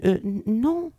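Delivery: hesitant, stammered little denial; unconvinced no. Retold or imitated. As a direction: answer as if you don't believe it yourself. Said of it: "c'était un non ni convaincant, ni convaincu". (un non hésitant, ni convaincant ni convaincu)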